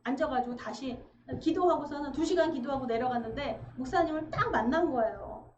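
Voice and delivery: ordinary delivery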